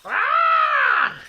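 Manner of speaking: very high, loud piercing scream; shrill scream. A narrator's reading. Missus Twit screams at the frog on her face. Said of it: "She screamed again".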